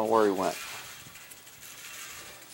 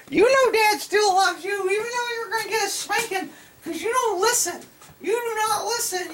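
tease-scold the kitty in a tiny voice. Real, not acted. Squeaky baby-talk scolding.

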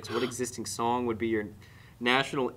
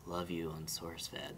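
low, slow and very soft; murmured fan greeting; 7 semitones lower, 13 dB quieter, slower than usual. Retold or imitated. Fan leaning over in a crowded elevator, murmured low. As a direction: imitate a stranger's hushed approach.

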